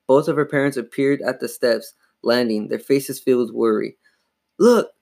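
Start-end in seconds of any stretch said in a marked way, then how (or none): 4.6-4.9 high, loud, sudden cry; frantic cry. A narrator's reading. Girl showing her parents the dummy, frantic cry. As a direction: cry out to make them see. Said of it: "Chris cried, frantically pointing down".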